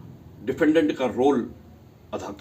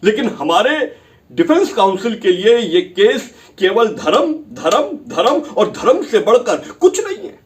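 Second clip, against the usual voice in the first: much louder and higher, hammering each धर्म; angry tirade; 7 semitones higher, 9 dB louder than usual. Acted. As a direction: lash out at the defence. Attacking defence counsel in court.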